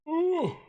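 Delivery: a high, loud, startled ooh; high surprised ooh. Real, not acted. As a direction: let out a sharp high ooh. Sudden reaction to a foul.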